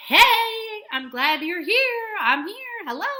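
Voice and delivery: bright, loud, high hello; cheery greeting. Shown as a demonstration. Teacher demoing a greeting, loud and high. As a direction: greet them like a happy friend. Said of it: "a way to say".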